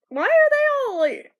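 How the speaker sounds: high, loud burst of disbelief; surprised shout. Real, not acted. Fan startled that the characters suddenly wear costumes, high loud outburst. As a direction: yelp it in surprise.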